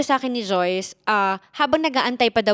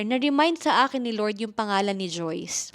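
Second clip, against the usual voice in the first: ordinary talk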